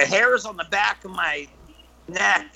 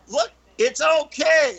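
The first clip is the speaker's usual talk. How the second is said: calling out reassurance; 10 semitones higher than usual. Retold or imitated. Storyteller calling to screaming kids in tunnel, high reassurance. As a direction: call across to calm them down.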